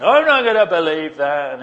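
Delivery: loud, mock-gloomy refusal; hammed-up stubborn doubter; doubting Thomas. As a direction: protest it loudly, like a comic pessimist. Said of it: "that carries on".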